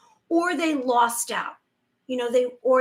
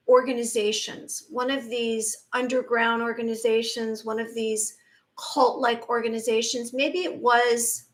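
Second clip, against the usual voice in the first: ordinary talk, nothing marked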